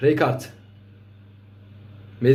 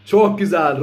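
ordinary talk, nothing marked

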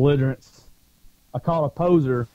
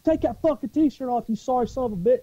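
high-pitched cussing demand; retold furious order; 10 semitones higher than usual. Marine orders a poser to strip off the shirt, retold fury. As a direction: act out a ranting command, not actually shouted. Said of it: "I'm screaming, I'm cussing".

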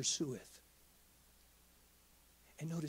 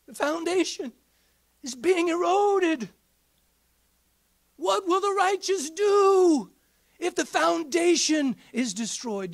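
high, loud, drawn-out preacher's cry; soaring sermon climax; preaching at full force; 11 semitones higher, 12 dB louder than usual. Acted. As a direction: lift the congregation with every word.

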